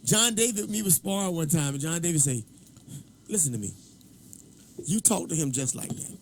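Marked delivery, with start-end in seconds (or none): 3.3-6.2 low, softer, imitating a stern warning; low-key warning. Sparring partner warns him in the ring, low-key warning. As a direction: quietly lay down a retold warning.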